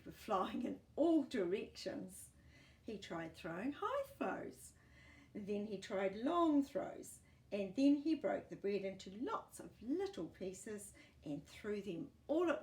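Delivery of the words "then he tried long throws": usual speaking voice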